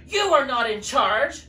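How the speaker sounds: loud, firm rebuke. Acted. Animals telling the stink bug off, loud and firm. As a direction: shut him down firmly.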